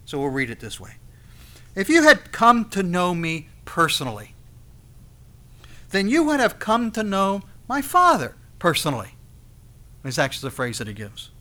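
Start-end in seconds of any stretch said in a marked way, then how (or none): 1.7-9.1 "me" leaned on in a loud, deliberate reading; stress on ME. Shown as a demonstration. Preacher reading the verse to show its emphasis, "me" stressed. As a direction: read it out emphatically, landing firmly on "me". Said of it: "The emphasis clearly is on the me".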